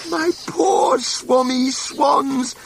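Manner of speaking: the Lorax croaking his complaint high; croaking cry. A narrator's reading. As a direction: cry out high through a smoggy throat. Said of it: "he cried with a crapulous croak".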